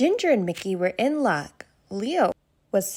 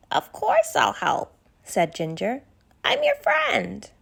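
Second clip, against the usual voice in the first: much higher, slow, loving giraffe; Ginger the giraffe; 7 semitones higher than usual. Acted. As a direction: promise her friends sweetly and slowly. Ginger promises help.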